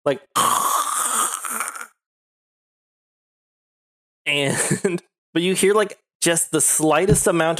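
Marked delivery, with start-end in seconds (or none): 0.3-1.8 long unvoiced snore; imitated snoring; sleeping dad. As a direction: snore like a dad asleep in his armchair. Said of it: "asleep in his armchair".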